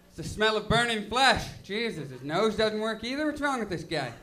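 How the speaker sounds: usual speaking voice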